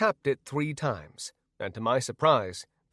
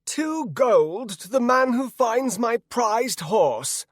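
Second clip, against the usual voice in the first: high, slow, loud proclamation; drawn-out town-crier style call; yelling townsman; 10 semitones higher, 5 dB louder, slower than usual. A narrator's reading. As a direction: yell it out across town. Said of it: "a man yelled on the other side of town".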